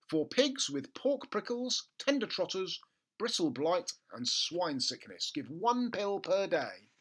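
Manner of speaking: high, slow official label voice; label read. Acted. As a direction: read it out like a pompous notice. Reading the label aloud.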